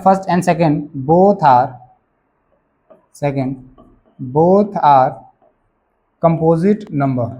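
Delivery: slow, word-by-word dictation. Real, not acted. Teacher giving students the answer to write, slow dictation. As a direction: dictate it with long gaps for writing.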